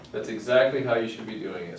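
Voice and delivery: ordinary delivery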